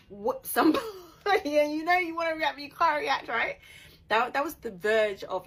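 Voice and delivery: loud, high, laughing through it; bubbling over with a laugh; laughing frustration. Real, not acted. Describing her bottled-up anger, laughing loudly.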